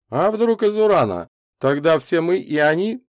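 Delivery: high, loud, dramatic speculation; alarming scientist. A narrator's reading. Микульский takes up the teasing, alarming. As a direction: raise the alarm for the visitor's benefit. Scientist plays along to scare visitor.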